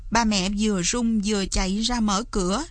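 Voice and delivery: ordinary delivery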